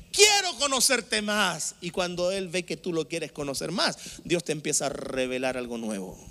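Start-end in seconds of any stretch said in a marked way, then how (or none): 0.1-1.7 loud, high, drawn-out declaration; impassioned prayer, raised and stretched; fervent model prayer. Acted. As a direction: cry it out slowly to God.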